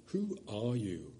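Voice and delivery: a soft, slow, awed question; awed hushed boy. A narrator's reading. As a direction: ask it under your breath. Shasta asks.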